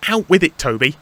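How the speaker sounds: high, loud, exasperated demand; impatient interruption. Acted. Thomas interrupting Toby, impatient. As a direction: cut in, pushing for an answer. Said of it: "interrupted Thomas impatiently".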